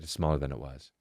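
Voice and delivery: very soft, low and ominous; imitating a worried wife, barely audible; hushed wife. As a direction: drop to a hushed, low report of bad news. Wife's grave answer retold.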